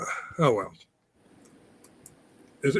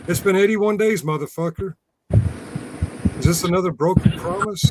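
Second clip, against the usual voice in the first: mock outrage; 6 semitones higher than usual. Mock outrage at unkept promise.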